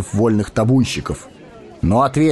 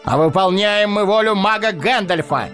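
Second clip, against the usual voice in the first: booming proclamation. A narrator's reading. Арагорн продолжает греметь.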